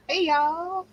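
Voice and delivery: light Southern twang on hey y'all. Acted. Cohost greeting the chat with a light Southern accent. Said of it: "Miss Accent".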